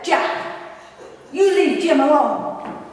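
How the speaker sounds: firm, drawn-out order to back off; stern command. Retold or imitated. Sister stepping in between her fighting brothers, stern. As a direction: tell him off firmly, each word weighted.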